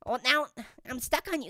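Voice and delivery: ordinary talk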